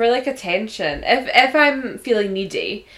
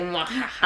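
mock gloating. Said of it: "And you're like".

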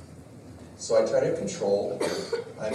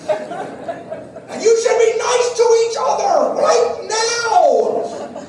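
ironic mock yell; 9 dB louder, much slower than usual. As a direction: yell the lesson on gentleness at full blast. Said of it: "me yelling at the children".

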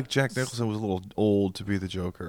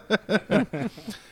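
high squealing laugh; 11 semitones higher than usual. Real, not acted. Laughing at own bit.

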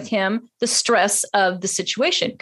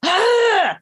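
frustrated shout; 8 semitones higher, 3 dB louder than usual. Retold or imitated.

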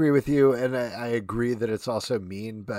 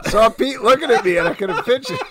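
laughing; 9 semitones higher, 11 dB louder than usual. Real, not acted. Laughing fit on air.

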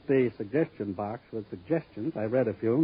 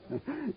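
short laugh; 12 semitones higher, 11 dB quieter than usual. Acted. Gangster laughs at Danny.

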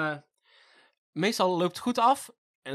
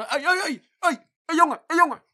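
high, loud calls of "hey, jongen"; street kids calling; 12 semitones higher, 8 dB louder than usual. Retold or imitated. Kids in the park calling after a cyclist, high loud calls. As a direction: call out at a passing cyclist.

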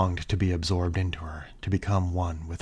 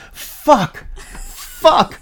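piercing high screams; horror movie screaming; 16 dB louder, much slower than usual. Acted. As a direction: scream it at the top of your lungs. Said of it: "she screamed with every thrust".

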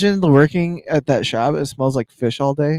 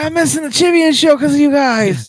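high, loud mocking dad; mock the annoyed dad; whiny dad voice; 13 semitones higher, 6 dB louder than usual. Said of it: "He's like".

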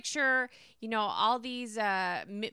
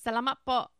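Filipino accent attempt; 3 dB louder than usual. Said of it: "I don't know if I can fake it".

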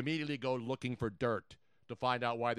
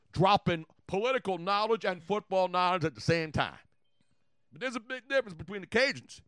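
high-pitched, excitable Cajun; hammed-up Cajun voice; Cajun accent; 5 semitones higher, 4 dB louder than usual. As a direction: do a lively Cajun character. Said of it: "No, I'm doing Cajun first".

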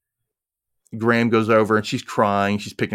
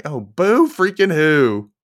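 mock boo-hoo; 6 semitones higher, 5 dB louder, slower than usual. Mocking Graham's complaint.

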